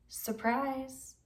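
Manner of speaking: soft, sly gloating word; a hint of creepy gloating; sinister reveal. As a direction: breathe it out like a villain.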